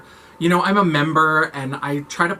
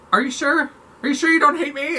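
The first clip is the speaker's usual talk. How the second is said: much higher, needy pleading questions; mock insecure; 11 semitones higher than usual. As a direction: fish for reassurance in a high, clingy voice. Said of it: "And I'll be like".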